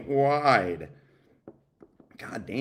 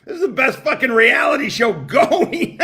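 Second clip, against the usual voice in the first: talking through real laughter, higher and louder; laughing; 11 semitones higher, 6 dB louder than usual. Real, not acted. Streamer mocking the reality show, laughing loudly. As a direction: laugh it out in delight.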